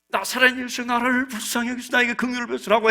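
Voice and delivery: lightly acted high plea; a touch of a beggar's cry; pleading cry. Acting the beggar's cry for mercy.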